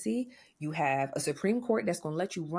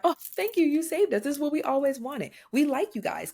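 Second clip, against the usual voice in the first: higher, louder sarcastic thank-you voice; mock gratitude; 7 semitones higher, 4 dB louder than usual. Mocking the thanks the government wants, high sarcastic gush. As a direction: gush like a fake grateful fan, dripping sarcasm. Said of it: "Because nobody is thanking you".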